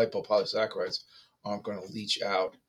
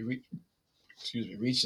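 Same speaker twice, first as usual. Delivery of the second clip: hiccups; 2 semitones higher, 3 dB quieter than usual. Real, not acted. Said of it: "My hiccups don't, don't help either".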